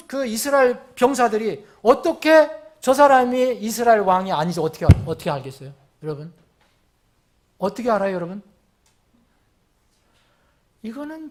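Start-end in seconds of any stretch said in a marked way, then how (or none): none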